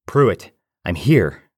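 loud, slow call across the room; calling out loud to a wounded friend. Acted. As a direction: call out to reach him. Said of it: "I called out".

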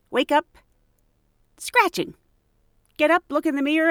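ordinary talk

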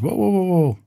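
high-pitched string of whoas, then the question; retold interrupting disbelief. Buddy cuts off his hedging, high retold surprise. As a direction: imitate a buddy stopping him short.

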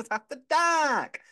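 high, loud, long-held "dark"; hammed-up sing-out; drawn-out catchphrase call. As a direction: belt out the show's name, stretching the last word.

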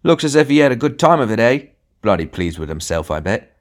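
a resentful voice in the crowd; sneering grumble. A narrator's reading. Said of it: "A growl went up from the queue".

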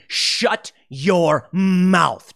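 very slow mock command; imitating Trump. Trump tells his advisors off, very slow mock command. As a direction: drag each word out like an order. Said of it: "he's just gonna be".

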